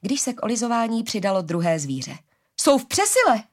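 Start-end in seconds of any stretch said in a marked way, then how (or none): 2.6-3.4 high, loud exclamation of dismay; acted panic, pitched way up; panicked alarm. A narrator's reading. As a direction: cry out that you are overwhelmed. Harari panics as more animals come.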